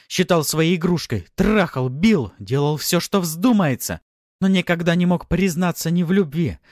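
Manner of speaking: high, loud, accusing woman; furious tirade. A narrator's reading. Wife listing her husband's wrongs, furious. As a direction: hurl every grievance at him.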